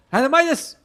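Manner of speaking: big hype call on "Midas"; excited shout. Shown as a demonstration. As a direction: shout "Midas" out high and loud. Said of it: "You have to say it in different ways".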